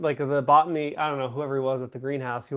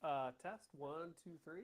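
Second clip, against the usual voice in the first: barely audible mic check; quiet, distant test count; faint audio; 21 dB quieter than usual. Real, not acted. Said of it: "You sound a little faint there".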